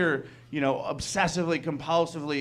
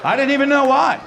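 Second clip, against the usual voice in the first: higher, louder, laughing rant; laughing outburst; 8 semitones higher, 4 dB louder than usual. Acted. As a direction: blurt it out half laughing. Reliving a violent outburst.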